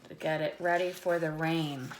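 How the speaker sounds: ordinary talk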